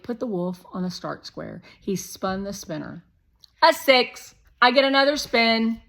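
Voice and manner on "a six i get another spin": put-on high, loud pushy boy; Shake voice. A narrator's reading. Narrator voicing Shake, a loud, high pushy boy. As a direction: play the bossy guest kid, loud and high.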